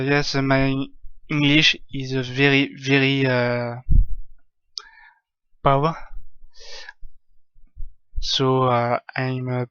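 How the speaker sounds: halting English in a thick French accent. Real, not acted. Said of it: "je sais pas si mon accent est suffisamment bon".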